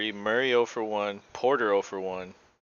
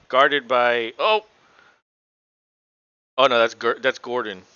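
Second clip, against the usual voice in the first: punchy, louder game call; live play-by-play; 5 dB louder than usual. Real, not acted. Commentator calls Denver's possession live, building play-by-play. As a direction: call each play as it unfolds, building to the foul.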